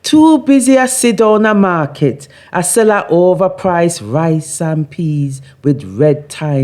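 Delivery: rhythmic Jamaican patois recitation, lifted and lilting; patois poem reading. Acted. Reads her patois poem aloud.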